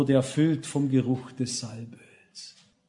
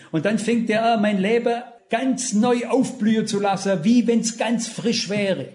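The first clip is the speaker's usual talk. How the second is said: higher, rapt sermon voice; uplifted preaching; 5 semitones higher than usual. Acted. Preacher describing the way to the heavenly sanctuary, rapt. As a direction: paint the way to heaven with awe.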